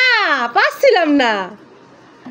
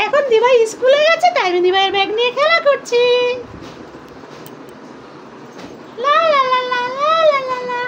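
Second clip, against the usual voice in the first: put-on high, fast little boy explaining; child voice; 7 semitones higher, faster than usual. Little son caught with sister's bag, in a fast high child voice. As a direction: gabble it out like a caught toddler.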